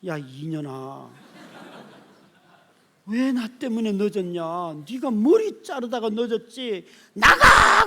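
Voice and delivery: builds from a slow mutter to a furious yell; father yelling at his late daughter, slow then exploding loud; angry father shout. Retold or imitated. Pastor reenacting yelling at his daughter, building to a loud shout. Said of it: "그래서 제가".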